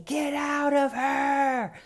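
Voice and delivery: high, slow shouted exorcism; shouting. Retold or imitated. Son shouting at the spirit in his mother, high and slow. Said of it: "he starts saying".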